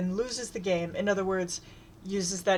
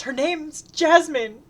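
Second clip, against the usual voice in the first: much higher, louder, slow indignant cry; 8 semitones higher, 8 dB louder, slower than usual. A narrator's reading. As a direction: cry out the name in protest. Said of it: "Zebediah cries".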